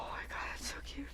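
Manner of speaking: breathless delighted whisper. Real, not acted. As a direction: let it slip out in a whisper. Gushing over tuxedo die.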